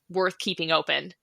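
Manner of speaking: ordinary talk, nothing marked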